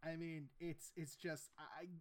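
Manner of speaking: ordinary talk, nothing marked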